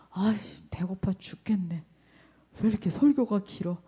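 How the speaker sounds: mock whispered complaint about the sermon; whispered grumble. Churchgoer griping about long sermon, mock whisper. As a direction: hush it like a bored pew-sitter.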